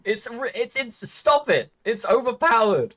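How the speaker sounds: high-pitched, exasperated protest; mock outrage. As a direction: complain in playful indignation. Mock outrage at adaptable accents.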